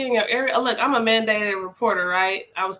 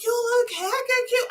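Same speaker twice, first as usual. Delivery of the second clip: cheerful redirecting; 13 semitones higher than usual. Retold or imitated. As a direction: gush over his sweater to distract him. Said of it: "I'm like".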